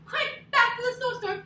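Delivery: high, loud hurried call; urgent shout. Acted. Story teacher to kids fleeing the bear, urgent. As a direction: urge everyone to flee fast.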